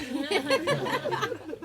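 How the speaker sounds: high burst of real laughter; long high delighted laugh. Real, not acted.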